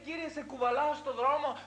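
normal talking voice

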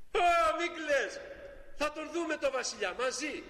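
high, kind little prince; reassuring child. Acted. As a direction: promise a friend gently. (μικρός πρίγκιπας, καθησυχαστικά)

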